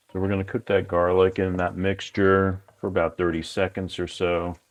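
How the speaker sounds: ordinary talk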